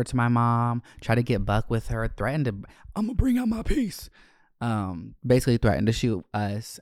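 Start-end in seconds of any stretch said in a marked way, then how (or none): 3.0-4.1 high-pitched, squawking threat; hammed-up imitation of an irate neighbour; mock threatening parent.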